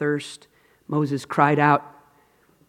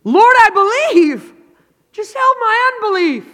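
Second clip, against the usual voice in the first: crying out; 8 dB louder than usual. Retold or imitated. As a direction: wail it out to the Lord. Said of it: "the father cried out".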